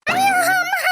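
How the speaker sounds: high loud ouch cry; pained cry. Acted. Woman struck, crying out high. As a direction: yelp in pain. Cries out when hit.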